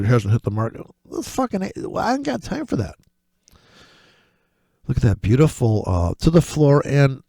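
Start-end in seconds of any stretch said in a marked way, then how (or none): 1.0-2.9 much higher, softer sassy brush-off; high sassy I ain't got time for that; mock dismissive. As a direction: wave it away in a put-on voice.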